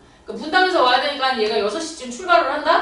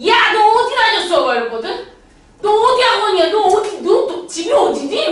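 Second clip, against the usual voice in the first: worked-up shouting; 9 semitones higher, 8 dB louder than usual. Retold or imitated. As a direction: fire the questions at the student in a rage. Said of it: "그날 내가 너무 흥분을 해가지고". (흥분해서 높고 크게 다그치는 외침)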